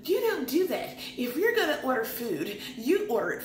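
a hint of Tanya laying down the rule; imitating Tanya. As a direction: scold like a table-manners teacher. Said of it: "And Tanya's like".

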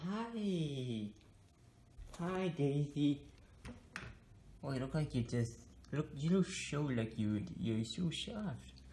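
soft cooing pet voice; pet talk. Real, not acted. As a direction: coo gently at the dog. Talking to pet Daisy.

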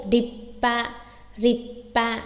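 "Ripa" with a long closed p; "Ripa" split by a silent catch; held breath stop. Shown as a demonstration. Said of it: "Giữ một phát hơi".

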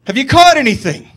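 lightly voiced Jesus hollering to the fishermen. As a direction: call across the water to the boat. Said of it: "he hollers out to 'em".

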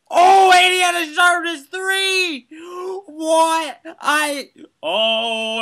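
high, loud shrieking joy; shrieking jackpot winner. Real, not acted. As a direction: scream like you hit the jackpot. Jackpot enchantment win.

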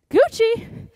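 high, loud shout of the move name; called-out cue. Real, not acted. Instructor to dancers, calling the step loud. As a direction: call the move out to the class.

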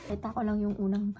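talking around toothbrush; foamy mouth while brushing; mouth full of toothpaste. Real, not acted. Seen 0: Toothbrush in mouth with foam throughout; speaker possibly partly off-screen.

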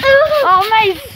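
high sudden alarmed call; startled cry. Real, not acted. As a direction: yelp his name in alarm. Brother nearly falls over.

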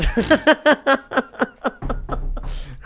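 gargalhada aguda e alta. Real, not acted. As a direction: racha o bico de rir. Ri da amiga chamando João. (rindo alto da amiga no set)

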